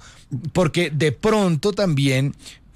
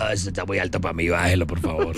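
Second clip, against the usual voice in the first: much lower put-on voice, dropping at the end; mock plea, sunk down low; singer asking for lower key; 10 semitones lower, 3 dB quieter than usual. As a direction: imitate a singer who can't reach the note. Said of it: "ya cuando se dice".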